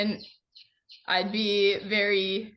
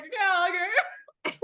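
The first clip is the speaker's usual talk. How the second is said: mock resigned giving in; 9 semitones higher than usual. Retold giving in to hug.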